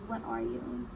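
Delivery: usual speaking voice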